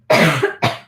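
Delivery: coughing, then struggling to speak; real choked-up voice between coughs; choking. Real, not acted. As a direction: force the words out after choking on saliva. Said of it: "I literally just choked on my own saliva".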